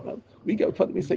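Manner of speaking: ordinary delivery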